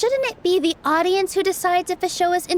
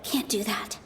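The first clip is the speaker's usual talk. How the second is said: soft, hushed thinking to herself; quiet inner thought; 9 dB quieter than usual. Acted. Nilou coaching herself silently mid-debate, soft and hushed. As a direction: murmur the plan under her breath.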